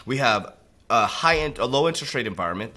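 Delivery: ordinary talk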